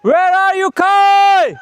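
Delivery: loud high call. Real, not acted. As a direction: call out searching for her. Calling out to find Kai.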